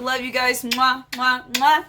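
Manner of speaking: blown kisses mwah; between kisses. Real, not acted. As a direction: kiss sounds to the camera. Blowing kisses to viewers.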